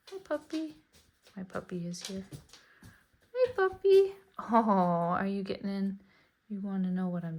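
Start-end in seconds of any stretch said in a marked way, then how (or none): 0.1-0.8 high, soft greeting to a dog; pet voice. Real, not acted. Greeting her puppy as it arrives, high coo. 3.3-4.2 much higher, louder squeal at the dog; excited pet voice. Real, not acted. Delighted to see her dog, loud high squeal.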